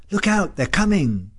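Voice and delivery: high, panicked scream; frightened scream. A narrator's reading. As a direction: cry the warning out in a high, frightened pitch. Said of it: "screamed Margot".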